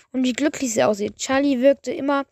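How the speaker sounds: ordinary delivery, nothing marked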